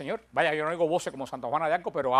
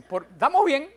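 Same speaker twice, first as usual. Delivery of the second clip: mimicking the couple; 9 semitones higher, 5 dB louder than usual. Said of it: "y me dicen".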